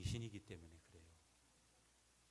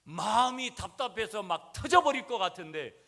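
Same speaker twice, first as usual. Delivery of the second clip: much higher and louder, nearly bursting; impassioned preaching; 10 semitones higher, 15 dB louder than usual. Real, not acted. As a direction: cry out the pressure in the heart. Preaching peak on heart bursting. (터질 듯 높이 외치는 설교)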